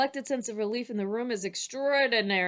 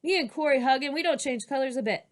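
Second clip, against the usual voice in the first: higher, louder acted indignation; angry child protest; 5 semitones higher, 4 dB louder than usual. Girl arguing with her teacher about hugging, angrily. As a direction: argue back as a cross little girl. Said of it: "glares at me angrier than I've ever seen her".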